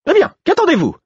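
lancer très aigu et fort; défier le garde avec mépris; crachat méprisant. A narrator's reading. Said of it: "cracha Patience". (Patience crache son défi au garde)

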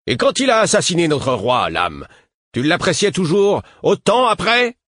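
provoque-le, hargneux; provocation moqueuse. A narrator's reading. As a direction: question railleuse, bien plus aiguë et forte. Garde provoque le vieux. (ton provocateur)

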